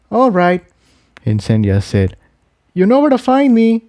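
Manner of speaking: lightly voiced gentle mare. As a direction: Incendia consoling Tiptoe. Character voice.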